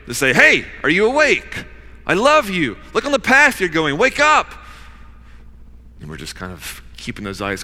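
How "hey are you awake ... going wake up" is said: high, loud urgent calling out; God shouting to wake a sleeping heart. Acted. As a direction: call out to rouse a sleeper.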